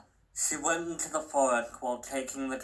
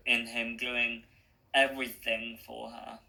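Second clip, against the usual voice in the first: ordinary delivery, nothing marked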